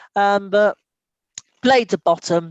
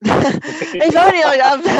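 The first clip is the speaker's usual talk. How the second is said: ordinary delivery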